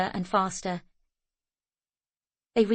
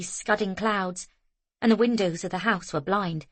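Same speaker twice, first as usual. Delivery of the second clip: ordinary delivery, nothing marked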